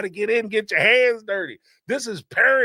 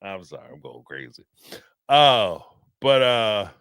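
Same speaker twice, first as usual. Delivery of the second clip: ordinary talk, nothing marked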